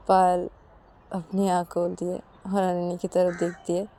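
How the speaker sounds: usual speaking voice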